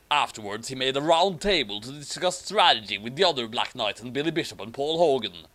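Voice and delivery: usual speaking voice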